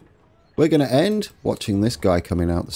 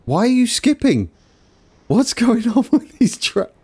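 surprised; 13 semitones higher than usual. Real, not acted. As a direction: yelp it at the glitching train. Train misbehaving in his game.

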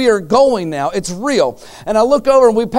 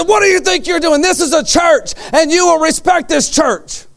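angry confrontation; 9 semitones higher than usual. Retold or imitated. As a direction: square up and call them out. Confronting intruders in church.

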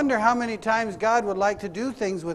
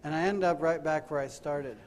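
soft, lowered, searching questions; hushed preaching; 4 semitones lower, 7 dB quieter than usual. Acted. Preacher challenging congregation about this week, hushed solemn preaching. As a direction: bring it right down and let each question sit.

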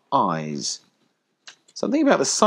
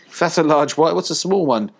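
high, loud puppy jibe; cheeky puppy; 9 semitones higher, 6 dB louder than usual. A narrator's reading. Puppy joking about the piglet, high and cheeky. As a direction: pipe up loudly, poking fun.

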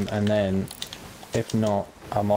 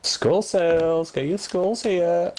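market trader call; 8 semitones higher, much slower than usual. Pretend. Hawking skulls like a market trader.

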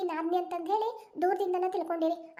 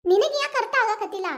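angry retort; 3 semitones higher, 4 dB louder than usual. Acted. Sister throws brother's words back.

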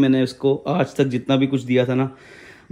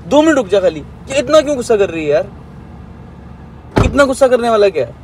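raised, urgent pleading voice, calling after her; loud pleading; 9 semitones higher, 7 dB louder than usual. Real, not acted. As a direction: beg her to stop and listen, loud and high. Pleading during heated quarrel. (प्रेमिका को रोकते हुए ऊँची आवाज़ में गिड़गिड़ाना)

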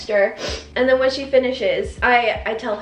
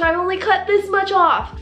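higher mock protest of the stylist; mimicked insistence; 6 semitones higher than usual. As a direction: imitate the hairdresser defending her cut. Stylist repeats her denial.

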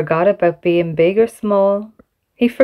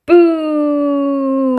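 jeering crowd; 6 semitones higher than usual. Acted. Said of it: "the crowd jeered".

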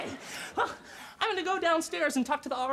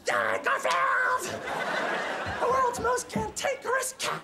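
much higher, slow, gushing cheer for Garfield; excited shout; 9 semitones higher, slower than usual. Acted. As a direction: cheer like a superfan showing off posters. Gushing over his Garfield posters.

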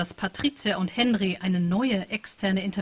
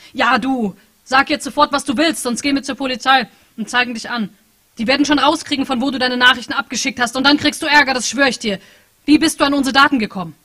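angry threat; 5 semitones higher, 9 dB louder than usual. Acted. Henry threatening the hacker.

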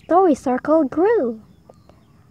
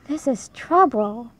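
mildly sighed worry; a touch of a sigh, understated. A narrator's reading. Ozzy frets over his runaway pet, lightly sighing. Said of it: "Ozzy sighed".

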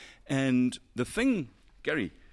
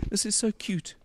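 gushing cute; 9 semitones higher than usual. Real, not acted. Gushing over a small part.

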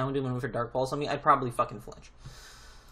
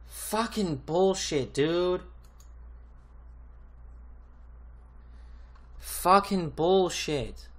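very slow, stretched, bitter curse; drawn-out angry curse; 3 semitones higher, much slower than usual. Real, not acted. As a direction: grind it out slowly in disgust.

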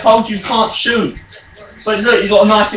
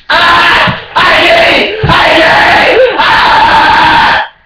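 high, loud excited yelling; raging gamer; 8 semitones higher, 5 dB louder, much slower than usual. Real, not acted. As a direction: scream it at the screen. Shouting after a shot.

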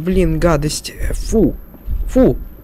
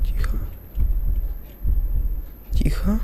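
hushed whisper to himself; 11 dB quieter than usual. Real, not acted. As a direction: keep it barely audible. Hiding from the monster.

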